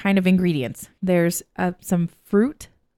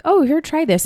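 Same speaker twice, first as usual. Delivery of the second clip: much higher, friendly offer; lightly voiced cousin, pitched way up; imitating a cousin offering; 9 semitones higher than usual. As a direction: hand over a drink in a lifted, eager voice. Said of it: "they're like".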